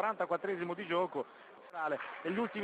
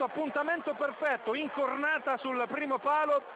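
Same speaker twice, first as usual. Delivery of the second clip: excited commentary; 6 semitones higher than usual. Real, not acted. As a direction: still buzzing from the goal. Recap of the goal, still elevated.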